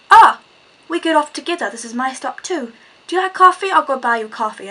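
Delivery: eager mock Greek accent, jumping up high. Stranger inviting her for coffee, in a mock Greek accent. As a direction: imitate a pushy Greek man, starting loud and high. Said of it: "a very strong Greek accent".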